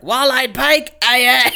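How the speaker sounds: a loud hammed-up pirate call; Muppet pirate. Podcaster quotes the Muppet pirate song, loud and hammy. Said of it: "Muppet Treasure Island where it's like".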